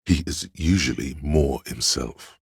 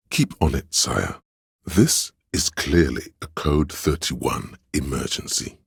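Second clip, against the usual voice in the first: normal talking voice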